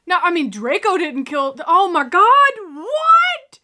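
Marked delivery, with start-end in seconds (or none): 1.7-3.6 loud shock climbing very high; stunned disbelief. Real, not acted. Viewer seeing Draco's scene turn deadly, shocked. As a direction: let the last word shoot up in disbelief.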